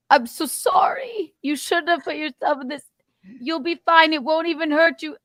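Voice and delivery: high, pleading fake sympathy; overly sweet firefighter bailing out; apologetic firefighter voice.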